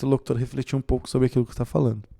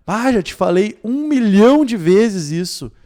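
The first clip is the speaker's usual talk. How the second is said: higher, louder, fed-up complaint; exasperated exaggeration; 7 semitones higher, 5 dB louder than usual. Shown as a demonstration. Teacher demoing hyperbole to students, exasperated. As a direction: overstate it with dramatic annoyance. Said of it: "Olha o exagero".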